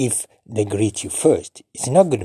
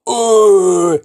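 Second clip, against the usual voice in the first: higher, louder uh; surprised gasp; 8 semitones higher, 9 dB louder than usual. Shown as a demonstration. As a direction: catch your breath in surprise. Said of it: "like you can't believe your eyes".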